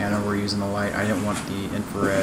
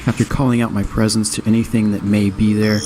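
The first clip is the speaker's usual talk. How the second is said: louder documentary voiceover read; voiceover narration; 7 dB louder than usual. A narrator's reading. Voiceover narration over music.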